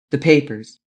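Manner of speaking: a cold clipped order from a master; harsh imperious demand. A narrator's reading. The Emperor demands the papers from Lupin, imperious. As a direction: demand it of an inferior, hard and short. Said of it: "harsh and imperious tone of the master".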